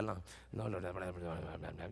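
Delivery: low grumbling mutter under the breath; a crowd grumbling to itself in low voices; murmuring. Shown as a demonstration. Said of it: "empiecen todos a murmurar".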